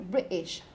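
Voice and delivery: "British" with the T caught in the throat; glottal stop. Shown as a demonstration. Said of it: "they stop their throats".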